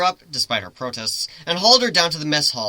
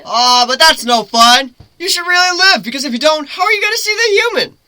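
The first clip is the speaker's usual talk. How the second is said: high, loud, playful griffin voice; griffin teasing; 13 semitones higher, 9 dB louder than usual. Acted. Griffin teasing Lyra mid-fall, high playful voice. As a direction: tease her lightly as you both fall.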